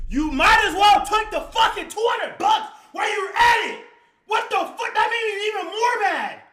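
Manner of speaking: high, loud enraged yelling; furious shout. Real, not acted. Reliving the theft to chat, shouting in anger. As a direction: let the anger burst out again. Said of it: "now I'm reliving it".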